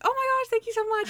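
very high, squealy put-on gush; high mock-flattered thank-you; gushing imitation. Natalie gushing at a fan.

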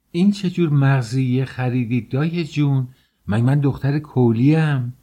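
high, loud indignant outburst; offended protest, pitched well up; indignant complaint. Acted. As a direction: scold the nanny over a wrong purchase. Acted complaint to the nanny.

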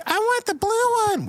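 put-on very high squeaky child voice; little kid voice. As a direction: whine it like a small kid. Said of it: "little kid goes like".

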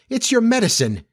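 high, reproachful realisation; dismayed discovery. A narrator's reading. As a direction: confront him, taken aback. Wife discovers the trick.

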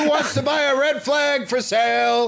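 high hawker's pitch, building to a stretched cry; street vendor cry. Pretend. Host pretending to sell red flags, vendor's cry. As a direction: call to buyers, ending very high and long.

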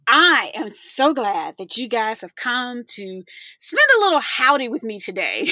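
ordinary talk

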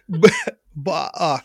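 normal talking voice

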